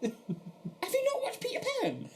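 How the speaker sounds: scandalised, voice shooting right up; incredulous. Real, not acted. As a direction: ask in exasperated, high-pitched amazement. Disbelief at films never watched.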